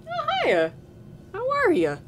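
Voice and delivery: high squeaky greeting. Acted.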